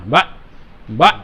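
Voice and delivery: বা cut very short with a chest jolt; jerked from the chest. Shown as a demonstration. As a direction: punch out বা abruptly, pushed from the chest. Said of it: "এরকম বুকের মধ্যে ধাক্কা দিয়েও পড়া যাবে না".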